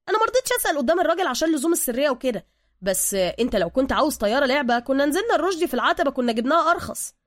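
lively, eager explaining in Cairene slang; chatty Egyptian sidekick. Acted. Suka unpacking bags for Bruce, chatty and loud.

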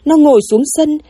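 plain reading voice

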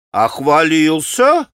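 taunting shout. A narrator's reading. Rakitin mocking Alyosha for backing down, shouting. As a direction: jab at Alyosha across the room. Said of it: "крикнул Ракитин".

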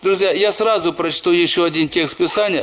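ordinary talk

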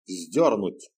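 a hint of bored command; curt order. A narrator's reading. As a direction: repeat the order, uninterested. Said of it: "повторил приказ всадник".

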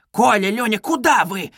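high, sudden alarmed call; frightened cry. A narrator's reading. Mother calling her fleeing children, frightened. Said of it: "вскрикнула она вдруг в испуге".

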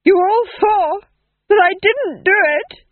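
high, loud hysterical pleading; frantic duke. Acted. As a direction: shriek the denial at everyone. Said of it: "said the Duke".

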